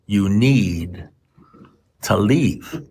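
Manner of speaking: spaced out, low-key and deliberate; retold slow, measured instruction; deliberate advice. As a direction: spell it out with long pauses between words. Slow advice to drunk friend.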